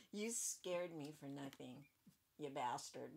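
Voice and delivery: soft unvoiced mutter; muttered under breath. Real, not acted. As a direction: mutter it so nobody hears. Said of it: "did I say that out loud?".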